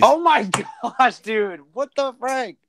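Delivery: high, slow laughing oh my gosh; laughing disbelief. Real, not acted. Reacting to glitching audio.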